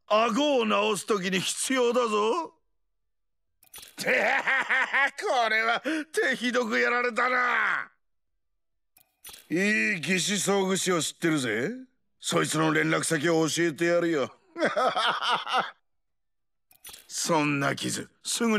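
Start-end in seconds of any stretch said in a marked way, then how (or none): 2.5-7.9 big laugh breaking into slow gloating; mocking laughter. Victor gloating over a badly beaten opponent, laughing. 14.6-15.7 high, rapid cackling laugh; mocking laugh. Victor offering a prosthetist's number, mocking laugh.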